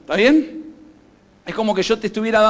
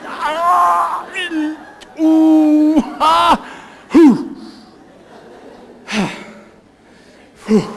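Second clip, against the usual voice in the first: loud, high held groan, then short puffs; strained wordless groan; 7 semitones higher, 5 dB louder, much slower than usual. Acted. Preacher showing himself holding back from fighting his wife, strained groaning. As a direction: strain out long vowels, then huff.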